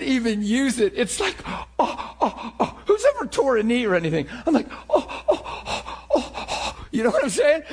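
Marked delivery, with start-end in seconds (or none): 1.5-2.7 breathy, broken ohs of knee pain; acted-out winces of sharp pain; mock pained gasps. Acting out pain of torn knee. 4.9-6.3 high, breathy ohs of pain; short acted yelps fading into gasps; mock pained gasps. Acting out pain of torn knee.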